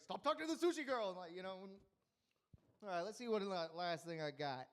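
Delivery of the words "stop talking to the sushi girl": fast, annoyed mock outburst; annoyed yell. Mafia guy scolding him at dinner, mock annoyed yell. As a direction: snap it like an irritated mafia boss. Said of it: "just get mad, annoyed".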